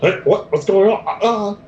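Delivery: high, loud startled cry; startled voice from behind the door. Acted. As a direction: yelp it high as a confused stranger. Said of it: "the secondary sound saying".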